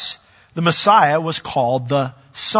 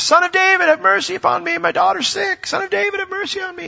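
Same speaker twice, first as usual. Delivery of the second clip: calling out. Retold or imitated. Syrophoenician woman calling out to Jesus, desperate high shout. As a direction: shout after him, begging. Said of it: "she was calling out to Jesus".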